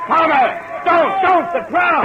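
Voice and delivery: high, loud yell across the street; frantic cry of warning; desperate shout. Acted. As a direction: scream at him to stop.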